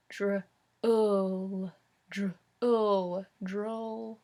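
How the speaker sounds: "dr-, ul" with the short oo of book, blended slowly into "droll". Shown as a demonstration. Teacher blends the word with the book vowel. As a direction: sound out each piece, then blend. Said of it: "O O as in book".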